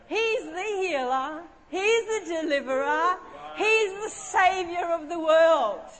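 measured declaration rising to a preacher's force; solemn proclamation. Retold or imitated. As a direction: proclaim it slowly with long pauses. Delivers Mary's message solemnly.

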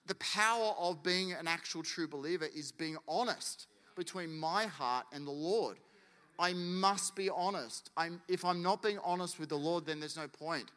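ordinary talk

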